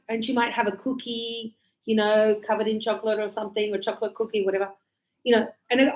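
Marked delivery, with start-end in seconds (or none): none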